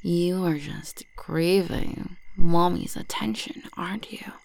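ordinary talk, nothing marked

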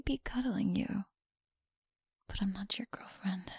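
teasing close whisper; breathy whisper to a listener. Acted. Whispered domination.

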